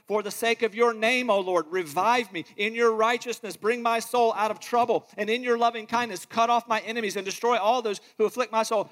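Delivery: fervent psalm reading; scripture reading. Acted. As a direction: read it as a heartfelt plea to God. Reads Psalm passionately.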